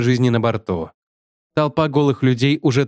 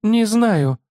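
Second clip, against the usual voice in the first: high, quavering fearful reply; trembling; 9 semitones higher than usual. A narrator's reading. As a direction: let the voice tremble. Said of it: "с дрожью произнесла она".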